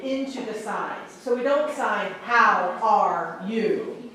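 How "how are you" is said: HOW, ARE, YOU drawn out one by one; slow word-by-word. Shown as a demonstration. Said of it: "We don't sign it that way".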